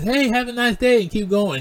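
bright high friendly goodbye; chirpy service voice; cheerful attendant. Retold or imitated.

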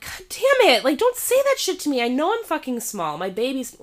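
high, loud frustrated outburst that fades; venting at the waitress in her head, fed up; exasperated rant. Retold or imitated. Retelling her silent rant at the waitress, exasperated.

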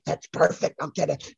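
usual speaking voice

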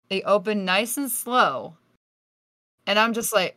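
ordinary delivery

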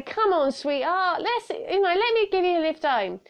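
hammed-up catcaller, high and coaxing; badly faked man wheedling for attention; bad man's voice. Man offering a woman a lift home, bad man's voice. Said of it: "I do a really bad man's accent".

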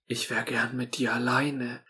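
soft whisper in her ear. Acted. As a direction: murmur it close and intimate. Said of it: "an ihr Ohr flüstern". (geflüstert ins Ohr)

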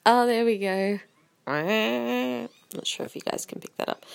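usual speaking voice

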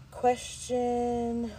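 audible heavy breaths through the nose; heavy nose breathing. Real, not acted. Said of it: "Stop breathing into the mic".

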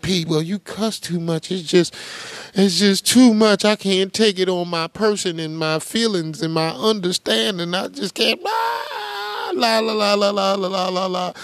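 high fake-crying la la la; mock a sensitive viewer whimpering; whiny mock crybaby. Host mocking viewers who hate cussing, fake sobbing. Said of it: "Y'all be like".

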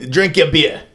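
put-on high-pitched caricature of a Boston accent; mock Boston accent. Host quoting Boston movie lines, mock Boston accent. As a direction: toss out Boston catchphrases in a hammed-up high voice. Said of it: "I don't know what that is".

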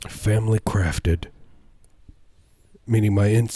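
ordinary talk, nothing marked

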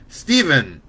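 loud sharp call; mother stopping her son, loud; scolding shout. A narrator's reading. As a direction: cut him off with his name. Mother cuts him off.

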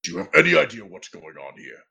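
low, fast menacing demand; menacing Triton. Acted. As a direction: loom over him and demand. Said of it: "and says".

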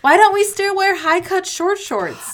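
high, loud plea; mock outraged enthusiasm. Acting out her own excited note.

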